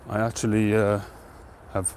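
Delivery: ordinary delivery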